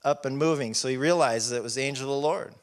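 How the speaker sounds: ordinary delivery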